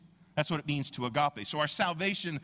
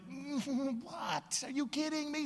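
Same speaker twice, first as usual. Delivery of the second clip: incredulous; 9 semitones higher, 5 dB quieter, slower than usual. Retold or imitated. Imagined reaction to more requests.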